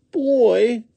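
very high, loud critter voice; puzzled woodchuck. A narrator's reading. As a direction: try out the strange word high. Woodchuck repeats the strange word.

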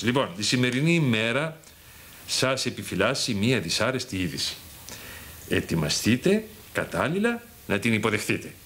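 slow, low, portentous reading aloud; solemn horoscope reading. Acted. Husband reads his wife's horoscope aloud, slow and ominous. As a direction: intone it like an oracle.

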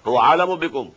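melodic, measured tajweed chant; Quran recitation. Acted. Teacher recites the verse to his students, chanted tajweed. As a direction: intone the verse as in prayer.

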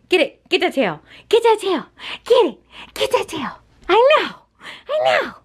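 high, eager cheering at the pet; pet talk. Acted. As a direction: egg the dog on in a high, sing-song voice. Playing with dog, pet voice.

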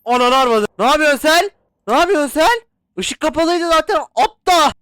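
high, loud frightened shouting; panicked yelling. Real, not acted. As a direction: yell in fright and anger. Jump scare, angry panic. (korku ve öfkeyle bağırma)

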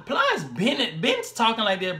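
put-on slow, stretched-out Florida accent; mock Florida rapper talk; imitated Florida drawl. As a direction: drag the words like a Florida rapper. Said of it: "So they really talk like this".

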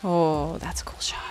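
breathy unvoiced whisper of wonder; whispered awe at a cool shot; hushed awe. Real, not acted. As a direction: murmur it under your breath, amazed.

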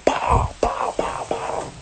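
unvoiced bow bow shots; whispered gunshot noises. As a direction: breathe out the gunfire. Mimicking gunshots.

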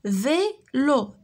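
"فيلو" slowly split into vé and lo; syllable by syllable. Shown as a demonstration. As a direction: say "فيلو" as two separate beats, vé, lo. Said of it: "عندنا دو سيلاب".

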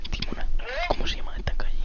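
hushed whisper to an accomplice; whispered aside. Real, not acted. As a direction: whisper so the caller won't hear. Whispered aside asking for a street. (susurro en un aparte)

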